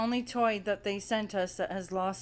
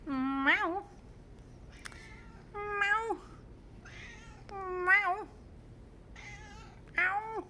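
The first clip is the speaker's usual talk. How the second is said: high, catlike meows climbing steeply; meowing to the cat; 12 semitones higher, 3 dB quieter, much slower than usual. Pretend. Owner meowing back to her stalking cat, high catlike meows. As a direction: call to him like a cat in his own tongue. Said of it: "I wonder what I'm saying back to him".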